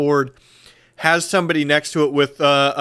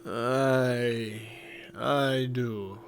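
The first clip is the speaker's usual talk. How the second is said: quiet gruff leader voice; 2 semitones lower, 11 dB quieter, much slower than usual. Acted. NPC grudging reply.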